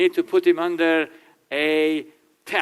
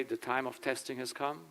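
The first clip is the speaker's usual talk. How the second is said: mock solemn; 2 semitones lower, 12 dB quieter than usual. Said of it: "And you say".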